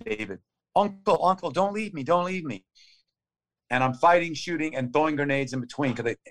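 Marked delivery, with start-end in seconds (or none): 0.8-2.6 a high, pleading echo of a dying friend; retold desperate plea, pitched up high; pleading wounded friend. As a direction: beg them not to leave you, voice raised. Dying friend begging not left.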